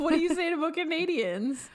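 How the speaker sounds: high-pitched, laughing mock challenge; laughing protest. Real, not acted. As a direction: tease through real laughter. Teasing the friend, both laughing.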